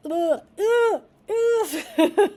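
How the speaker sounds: loud, high squeamish "ugh"; cringing groan. Real, not acted. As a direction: recoil with repeated high groans, breaking into a laugh. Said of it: "Cringing, cringing".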